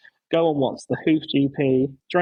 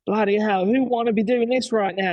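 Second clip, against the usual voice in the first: high, chirpy Australian tradie voice, pitched up; playful Australian bloke impression, pitched up; Australian accent impression; 7 semitones higher than usual. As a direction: do the Aussie drain guy, cheerful and cheeky. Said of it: "He's like".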